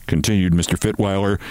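ordinary delivery

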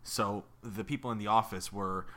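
ordinary talk, nothing marked

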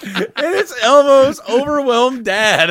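high-pitched laugh through the words; can barely get it out for laughing. Real, not acted. Cracking up at headline.

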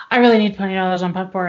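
quick-fire request, imitating yourself in a hurry; too-fast talking. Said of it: "learned a hard lesson about slowing it".

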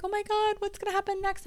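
high, mock-panicked fretting; hammed-up anxious squeal. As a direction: play the worried version you refuse to be. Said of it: "I'm not gonna be like".

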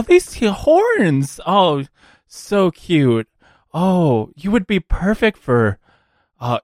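high, swooping cooing over someone cute; sing-song gushing businesswoman; gushing recruiter. Acted. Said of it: "and she goes".

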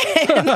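short high laugh; a quick delighted chuckle; real laugh. Real, not acted. Laughing at the fan's second tweet.

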